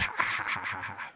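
low, put-on demon chuckle; hammed-up evil laugh, low and dying away; creepy demon laugh. Shown as a demonstration. Preacher playing the demon for laughs, creepy demon laugh. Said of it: "it should be like a creepy demon voice".

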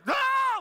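very high, loud shriek; mock passenger scream. Passenger while someone else drives, mock terrified scream. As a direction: burst out in hammed-up alarm.

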